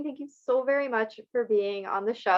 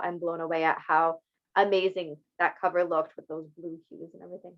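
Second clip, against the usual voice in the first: ordinary talk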